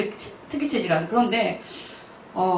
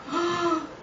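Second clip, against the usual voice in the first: startled gasp; 7 semitones higher, 6 dB quieter than usual. Retold or imitated. Sees vision with eyes open.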